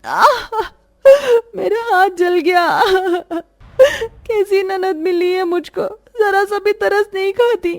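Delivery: high, sobbing cry of a burnt hand; crying in pain. Acted. Khushi burnt by hot oil, crying and sobbing. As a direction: cry out and sob through the words. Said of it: "रोते हुए बेचारी खुशी".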